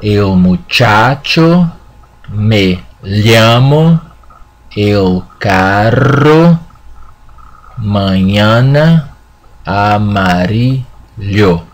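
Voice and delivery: el muchacho, me llamo, el carro, mañana, amarillo said slowly, one by one; slow Spanish repetition. Shown as a demonstration. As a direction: read each word slowly for the class to copy. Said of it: "Okay, one more time".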